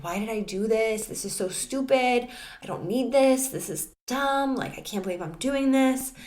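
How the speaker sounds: a touch of frantic inner scolding; frustrated self-scolding. Retold or imitated. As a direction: scold yourself in mock disbelief. Inner panicked self-criticism.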